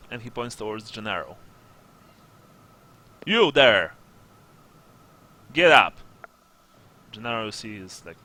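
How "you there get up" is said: loud, barked order; commanding sergeant. Acted. Sergeant orders Gennaro out of bed, commanding. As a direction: call out sharply to rouse someone.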